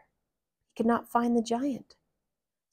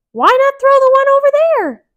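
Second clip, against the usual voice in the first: Mickey voice; 16 dB louder than usual. Acted. Mickey speaks.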